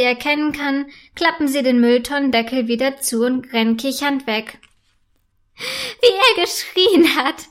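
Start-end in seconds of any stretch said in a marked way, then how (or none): 5.6-7.5 high, slow, gleeful mockery; amused, higher-pitched squeal; giggling. A narrator's reading. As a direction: say it through giggles, drawn out. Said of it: "sagt Clara kichernd".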